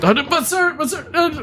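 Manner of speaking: high, fading plea; desperate plea. Acted. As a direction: trail off begging.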